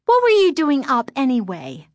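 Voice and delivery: put-on high, loud demanding girl; demanding sister. A narrator's reading. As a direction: press her for an answer. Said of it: "Lindy demanded".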